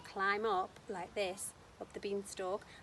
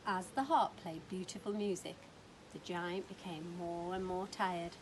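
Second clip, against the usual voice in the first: usual speaking voice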